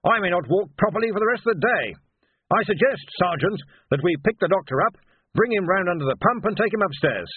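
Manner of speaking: high-pitched, blustering wizard; Ridcully. Acted. Wizard suggesting to the sergeant what to do, high and brisk. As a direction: pitch it up, brisk old wizard taking charge.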